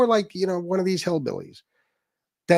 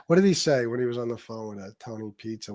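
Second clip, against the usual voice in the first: ordinary talk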